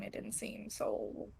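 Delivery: hushed whisper, breathy and voiceless. Real, not acted. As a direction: whisper it aside to the table. Aside to party.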